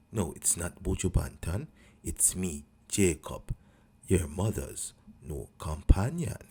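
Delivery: lazy low Rasta greeting; low laid-back Rasta; deep Rasta voice. Acted.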